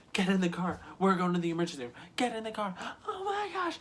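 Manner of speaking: high-pitched frantic orders; panicked mom. Retold or imitated. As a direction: imitate a mother panicking, climbing to a squeal. Mom in emergency, quoted.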